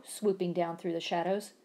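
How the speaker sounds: usual speaking voice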